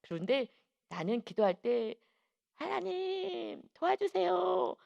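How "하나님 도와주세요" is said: mock crying-out prayer. Teacher showing children how to pray, drawn-out cry. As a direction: stretch each word in a high imitation of a cry. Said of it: "하고 소리를 막 질러".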